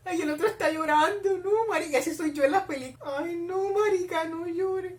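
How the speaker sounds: high, whiny dismay; sympathetic wail. Real, not acted. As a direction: plead in a high-pitched whine. Upset that character is crying.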